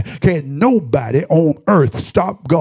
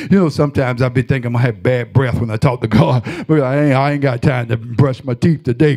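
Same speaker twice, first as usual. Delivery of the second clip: ordinary talk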